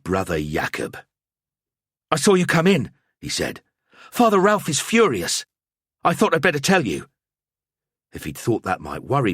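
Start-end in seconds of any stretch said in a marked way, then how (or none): 2.1-2.9 put-on higher, louder anxious young monk; a nervous novice blurting it out; young monk Jakob. A narrator's reading. Monk warning his friend, anxious and higher. 4.1-7.1 put-on higher, louder anxious young monk; a nervous novice blurting it out; young monk Jakob. A narrator's reading. Monk warning his friend, anxious and higher. Jakob warns of Father Ralph.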